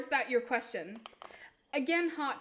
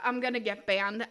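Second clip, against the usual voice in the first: ordinary delivery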